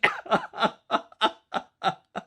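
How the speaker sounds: loud amused laugh; hearty laugh. Acted. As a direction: laugh out with delight. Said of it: "he smiled".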